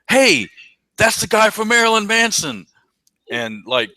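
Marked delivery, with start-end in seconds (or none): 0.1-2.7 loud, high-pitched shout; retold yell. Buddy spots the musician outside the venue, excited yell. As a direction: yell it out like calling across a street. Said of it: "and he yelled".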